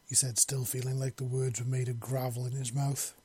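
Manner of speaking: ordinary delivery, nothing marked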